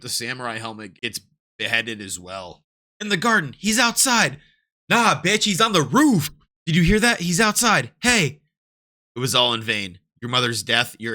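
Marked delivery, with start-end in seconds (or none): 3.0-8.4 higher, much louder shouts of soldiers; guards shouting. Acted. Guards hunting the intruder, higher loud shouts. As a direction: yell the alarm across the yard.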